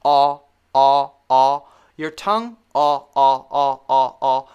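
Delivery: loud, held open "ah"; open ah demo. Shown as a demonstration. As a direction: drop the jaw and sing out "ah". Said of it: "when you're saying the ah sound".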